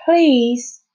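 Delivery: desperate little plea; pleading. A narrator's reading. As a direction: beg with the single word. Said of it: "he pleaded".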